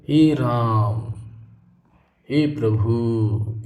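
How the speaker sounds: "हे राम, हे प्रभु" said very slowly with long held vowels; drawn-out vowels. Shown as a demonstration. Teacher showing long pronunciation, slowly drawing out "हे राम". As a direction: stretch every vowel of "हे राम, हे प्रभु" like a sigh of prayer. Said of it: "इस तरह से करते हैं हम".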